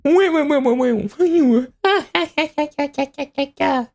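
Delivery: high evil cackle rolling down; beast laughing. Acted. Beast gloats over the kill, evil cackle. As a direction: cackle like a monster savoring it. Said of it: "That's the beast laughing".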